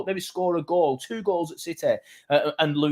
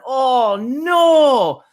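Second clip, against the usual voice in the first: high, loud stunned "Oh, no. What?"; reenacted shocked reaction; 10 semitones higher, 8 dB louder, much slower than usual. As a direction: relive the moment the draw came out. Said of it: "And I literally just went".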